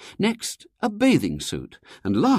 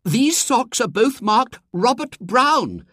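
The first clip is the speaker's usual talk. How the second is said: indignant; 13 semitones higher, 6 dB louder, slower than usual. A narrator's reading. Indignant discovery.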